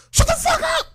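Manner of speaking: very high-pitched mock yell; retold yell. Coworker yelling in the middle of the meeting, retold screech. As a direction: imitate a sudden screeching outburst. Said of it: "dead ass yelled".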